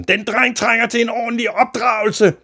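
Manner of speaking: high, sustained loud yelling; shouted outrage. A narrator's reading. Father demands his son be brought up properly, shouting. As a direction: rant at full volume. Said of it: "råbte faren".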